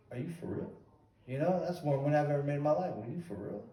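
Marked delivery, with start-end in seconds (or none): 0.1-0.7 quieter, as if thinking to himself; retold inner thought. Fighter doubting a huge offer to himself, quiet inner thought. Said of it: "And I'm thinking". 2.9-3.6 much softer, an aside to himself; retold inner thought. Fighter thinking about the money offered, quiet inner thought. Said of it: "And I'm thinking".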